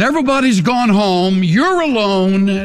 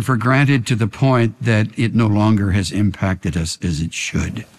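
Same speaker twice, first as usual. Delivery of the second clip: pastor closing in prayer over the church; 8 semitones lower than usual. Acted.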